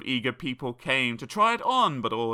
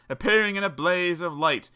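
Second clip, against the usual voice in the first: ordinary delivery, nothing marked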